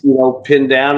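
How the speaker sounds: ordinary talk, nothing marked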